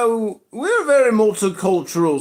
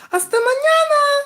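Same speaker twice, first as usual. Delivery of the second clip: put-on "Hasta mañana" squeaked much higher as a girl's voice; mock desk clerk. Front desk girl saying goodbye to him, in a high mock girly voice. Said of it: "the girl at the front desk said".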